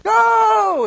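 sudden high cheering yell; shout. Retold or imitated. Pastor mimicking a fan's touchdown yell, shouting.

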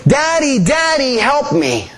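put-on a storyteller's understated child's scream; mild mock scream. A narrator's reading. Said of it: "he heard his son screaming".

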